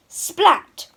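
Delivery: a big wet splat, high and loud; loud splat sound. A narrator's reading. Narrator makes the pond go splat loudly. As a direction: land the word like a belly flop.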